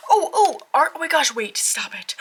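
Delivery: high, breathless gush of delight; excited squeal. Real, not acted. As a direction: squeal at the card. Excited card reveal.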